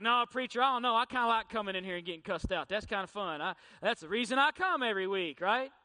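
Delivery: loud, hammed-up sarcastic churchgoer; a lightly voiced congregant teasing the preacher; mock congregant. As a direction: play a sarcastic church member for laughs. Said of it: "They're like".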